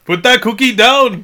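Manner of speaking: high, loud, slow failed Schwarzenegger shout; failed action-hero impression, shouted; bad Arnold impression. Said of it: "I can't do an Arnold Schwarzenegger".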